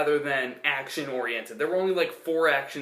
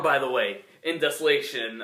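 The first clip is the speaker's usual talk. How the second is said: normal talking voice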